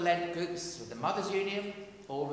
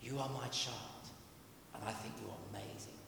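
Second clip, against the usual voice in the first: softer, lower, tender reassurance; gentle Father's voice; 5 semitones lower, 10 dB quieter than usual. Acted. Preacher voicing the Father to the congregation, tender and soft. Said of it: "hear the Father saying to you".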